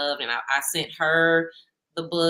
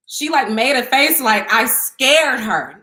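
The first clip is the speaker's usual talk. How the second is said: animated retelling; 5 semitones higher, 9 dB louder, slower than usual. Real, not acted. Retelling the awkward scene.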